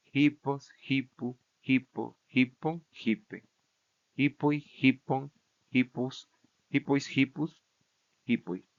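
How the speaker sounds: "Hipos, hipu, hipo" recited form by form, each stressed on HI; measured declension drill, HI stressed in "hipon", "hipus", "hipoi"; stress held on first syllable. Shown as a demonstration. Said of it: "Todo el acento se va a mantener en la misma posición".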